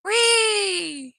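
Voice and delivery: high, gleeful squeal; excited whee. Real, not acted. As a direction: squeal with delight mid-air. Car flying off a jump.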